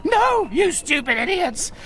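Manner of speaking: high furious yell at the fools; angry shout. Acted. Gargamel yelling at the bumbling Smurfs, angry shout. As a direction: bark it out in disgust.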